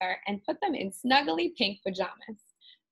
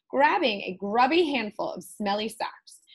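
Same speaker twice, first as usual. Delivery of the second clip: usual speaking voice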